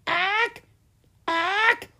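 very high squeaky creature squawk; baby dragon cry. A narrator's reading. Baby dragon hatching, high squeaky squawks. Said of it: "it cried".

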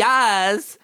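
a loud, drawn-out mock "Yas"; imitating a stranger. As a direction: call out "Yas" like the woman on the crate. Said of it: "this woman sitting on a crate".

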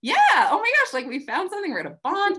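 very high, loud thrilled outburst. Retold or imitated. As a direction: gush over finding common ground. Mock excitement at common ground.

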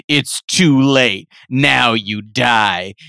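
slow, low menacing computer voice. Acted. Comedian voicing the evil laptop, slow and low. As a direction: drop it low and slow like a villain. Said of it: "the computer messages back and goes".

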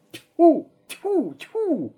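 high sharp spitting sounds. A narrator's reading. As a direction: spit the mud out in disgust. Spitting out mud.

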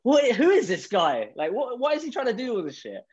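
high-pitched mock incredulity; astonished, high-voiced quote; acted-out bafflement. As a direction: retell a baffled reaction, pitch jumping right up. Retells own astonished reaction.

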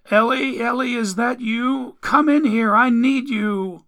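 high-pitched, needy mother's call; woman calling. A narrator's reading. Mother calls Ellie from the bedroom, high needy calling. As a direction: call out high for your child. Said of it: "Then a woman's voice called".

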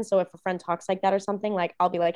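ordinary talk, nothing marked

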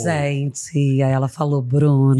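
low-pitched celebrity impression; Fernanda Paes Leme impression. Telling a friend's Noronha gossip, low mimicked voice. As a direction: drop the voice low and retell in her manner. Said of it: "a Fernanda Paes Leme".

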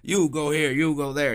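barking orders through a cigar; bossy plant manager. Retold or imitated. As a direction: round up the workers like a foreman. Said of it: "with the cigar in his mouth".

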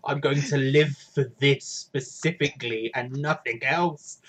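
ordinary delivery

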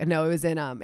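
ordinary talk

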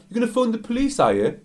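very high mocking question, dropping on the tag; acted taunting echo. Customer mocks the girl's threat, acted taunting.

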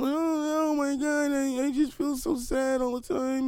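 high, slow whiny moan; mock self-pitying woman. Mocking people who claim sadness, high slow whine. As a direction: ham up a sorrowful complainer. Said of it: "But then".

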